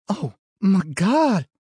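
high, slow horrified cry; shocked exclamation. A narrator's reading. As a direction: gasp it out in shock. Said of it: "Beth exclaimed".

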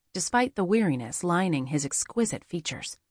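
plain reading voice

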